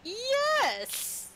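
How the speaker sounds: high, loud delighted yes; gleeful cheer; excited yes. Real, not acted. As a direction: burst out with joy.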